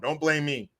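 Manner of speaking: usual speaking voice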